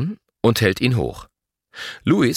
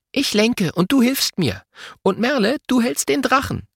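put-on high-pitched child taking charge; decisive little team leader; 13 semitones higher than usual. A narrator's reading. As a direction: hand out the jobs firmly. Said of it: "Lotta entscheidet".

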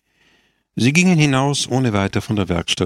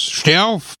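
commanding; 9 semitones higher than usual. Acted. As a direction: order him up harshly. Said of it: "befahl er". (scharfer Befehl)

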